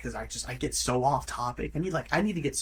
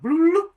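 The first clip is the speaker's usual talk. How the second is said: quick bloop, pitched way up and loud; high loud bloop; 14 semitones higher, 8 dB louder than usual. Shown as a demonstration. Caller demonstrating his switch to the prank character, high loud bloop. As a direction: pop out a high, silly bloop. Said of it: "I go like".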